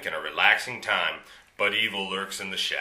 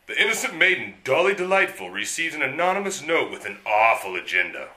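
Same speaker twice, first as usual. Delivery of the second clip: melodramatic narration; 4 semitones higher, 4 dB louder than usual. Acted.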